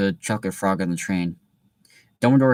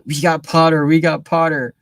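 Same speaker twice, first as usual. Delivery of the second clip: loud, higher triumphant chant; yelling; 7 semitones higher, 7 dB louder than usual. Acted. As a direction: yell it like a victory cheer. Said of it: "the Weasley twins yelled".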